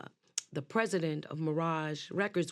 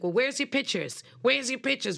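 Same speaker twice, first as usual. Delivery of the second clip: arrogant demand; 6 semitones higher, 4 dB louder than usual. Retold or imitated. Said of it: "Very arrogant Italian guy".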